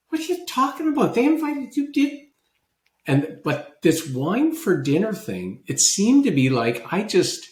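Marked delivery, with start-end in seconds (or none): 0.1-2.2 high, fast mock disbelief; incredulous scoff. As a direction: act baffled, protest quickly and high. Retold scoffing at thank-you card.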